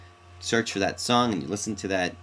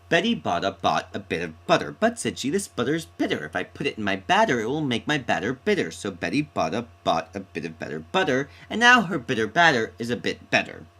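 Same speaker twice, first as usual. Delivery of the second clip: rattled off fast, a little louder; tongue twister; 3 semitones higher, 4 dB louder than usual. Shown as a demonstration. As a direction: show off the tongue twister with bounce. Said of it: "Many years of theatrical training".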